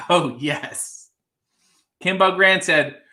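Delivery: normal talking voice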